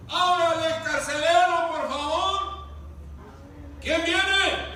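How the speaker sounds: loud, high call to the jailer; guardias llamando. Acted. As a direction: call out across the prison gate. Imita a guardias llamando al carcelero. (llamado fuerte al carcelero)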